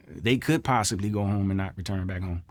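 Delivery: usual speaking voice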